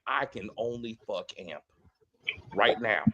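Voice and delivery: low, soft mock delivery; imitated woman character. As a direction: drop down low and quiet. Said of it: "new gr-, woman walks up, it's like".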